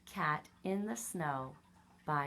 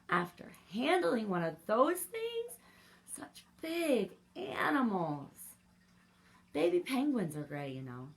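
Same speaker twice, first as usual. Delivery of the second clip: higher, whiny complaining crayon voice; lightly voiced tired crayon; weary gray crayon; 4 semitones higher, 7 dB louder than usual. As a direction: sigh it out like an overworked crayon.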